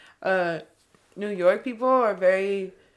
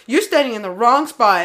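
loud, curt scold from the stranger; snappy scold; 2 semitones higher, 8 dB louder than usual. Retold or imitated. Rude stranger scolding her on the train steps, loud and curt. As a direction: bark it like an annoyed stranger.

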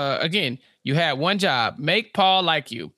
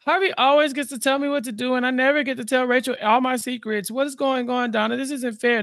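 high, self-pitying whine; hammed-up aggrieved griping; mock pity party; 6 semitones higher than usual. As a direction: complain like it's all unfair, pitched up. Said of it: "throwing his little pity party".

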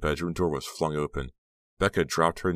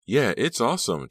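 bright jump up in pitch, louder; high, lively and enthusiastic; 8 semitones higher, 6 dB louder than usual. A narrator's reading. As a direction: chime in with eager approval. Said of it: "Trish added enthusiastically".

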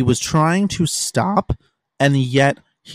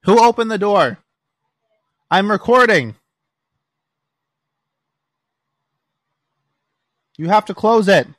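loud and higher, calling across the room; exasperated raised voice; real annoyed shout; 8 semitones higher, 5 dB louder than usual. Real, not acted. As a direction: yell at the intruder to shut the door. Someone walks in while recording.

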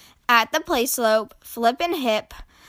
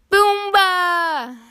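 high, drawn-out gleeful call; high-pitched game cry; playful shout; 8 semitones higher, 4 dB louder than usual. A narrator's reading. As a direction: shout it like a kid at play. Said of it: "shouted Flip".

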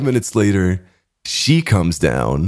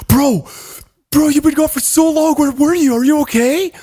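much higher, urgent mock panic; mock freaked-out friends. Coked-up friends panicking at their missing buddy, high excited voice. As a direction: squeal it like worried coked-up friends.

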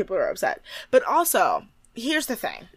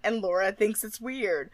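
ordinary talk

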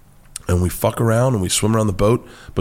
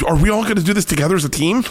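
rising higher and faster with each question; panicky questions; 11 semitones higher, faster than usual. Retold or imitated. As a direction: fire off nervous questions, quick and climbing. Panicking diver quoting himself.

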